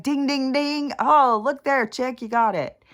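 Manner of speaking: high, loud sing-song ding ding; mock game show ding. Sarcastic reply to the hairdresser, high and loud like a quiz bell. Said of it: "And I was like".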